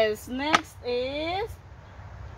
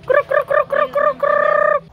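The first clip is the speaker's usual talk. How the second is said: high loud croaking; frog croak imitation; 13 semitones higher, 8 dB louder than usual. Animal noises for toddler.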